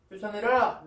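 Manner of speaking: loud, forceful order; stern command. Retold or imitated. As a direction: give Paul's order with a sudden burst of volume. Voicing Paul's stern command. (큰 소리로 단호한 명령)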